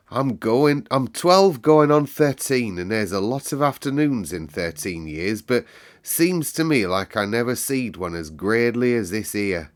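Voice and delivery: a hint of a Yorkshire boy, bursting. Dickon tells them the afternoon is best, eager Yorkshire. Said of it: "he said".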